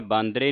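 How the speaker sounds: ਬਾਂਦਰੇ with nasal baan and closed e ending; wrong pronunciation. Shown as a demonstration. Teacher saying not to add the dot, wrong pronunciation. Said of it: "ਨਾ ਹੀ ਬਿੰਦੀ ਲਾਉਣੀ ਹੈ".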